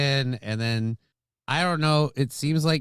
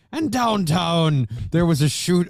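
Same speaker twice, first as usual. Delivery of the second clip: slow, louder, higher botched Cookie Monster news read; mock anchor Cookie accent; 5 semitones higher, 4 dB louder than usual. Co-host tries reading local news in the anchor's Cookie voice. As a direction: try the Cookie voice on a news line, badly. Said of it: "I can't even do it".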